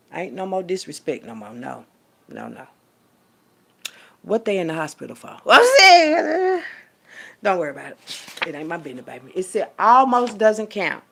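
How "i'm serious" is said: high, loud, slow laughing protest; cracking up while insisting; laughing insistence. Real, not acted. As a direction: laugh through the words.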